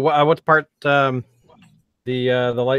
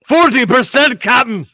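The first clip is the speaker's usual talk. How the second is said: high, loud Scottish engineer's report; Scotty; 11 semitones higher, 7 dB louder than usual. Acted. Engineer reporting shields to the captain, loud Scottish accent. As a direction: call it out in hammed-up Scots.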